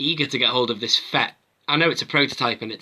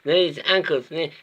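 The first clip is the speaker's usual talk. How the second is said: higher, louder mocking recital of features; mock toy reviewer; 6 semitones higher, 5 dB louder than usual. Figure reviewer mocking others' joint-by-joint rundowns, sing-song mockery. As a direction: rattle off joints like a parody reviewer. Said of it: "Loads of re-, reviews do that".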